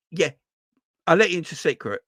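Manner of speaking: ordinary talk, nothing marked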